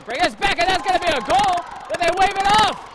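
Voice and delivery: high loud shouted goal call; excited goal call. Acted. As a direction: shout it like the puck went in. Goal call then waved off.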